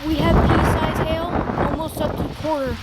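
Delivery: high, loud call over the hail; shouting over storm. Real, not acted. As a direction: shout to be heard over the noise.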